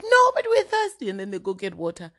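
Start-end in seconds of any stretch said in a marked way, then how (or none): 0.0-1.1 put-on high whiny child voice, louder; whiny little kids. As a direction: whine like a thirsty little cousin. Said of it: "they're like".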